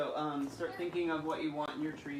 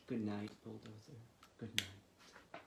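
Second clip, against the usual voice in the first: quiet, lower goodnight; soft goodnight; 5 semitones lower, 5 dB quieter, slower than usual. Acted. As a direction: whisper goodnight to a sleepy truck. Bedtime goodnight refrain.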